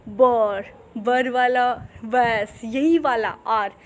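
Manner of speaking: बर stretched very slowly; drawn out. Shown as a demonstration. Teacher showing the father-style आर, very slow. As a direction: hold the बर sound. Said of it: "father वाला आर है".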